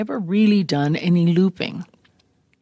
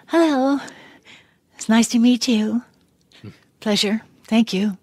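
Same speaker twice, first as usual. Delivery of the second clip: lightly pitched-up purring greeting; sultry cat voice; 5 semitones higher than usual. Retold or imitated. Auditioning for the cat role, a touch of purring cat voice. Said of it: "I talked in this voice".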